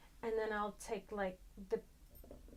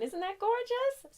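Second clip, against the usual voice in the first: gushing; 13 semitones higher, 8 dB louder, slower than usual. Real, not acted. Showing off a pin.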